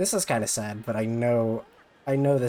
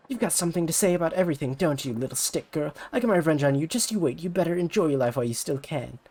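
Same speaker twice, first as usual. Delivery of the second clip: fast, petty threat; vengeful rival idol; 5 semitones higher than usual. Acted. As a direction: swear revenge like a sore loser. Ulala vows revenge.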